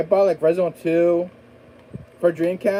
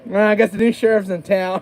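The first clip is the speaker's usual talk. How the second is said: lightly voiced drawling lawman, higher, louder; sheriff voice; 5 semitones higher, 5 dB louder than usual. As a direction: play a hammed-up small-town sheriff. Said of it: "my impression of a sheriff".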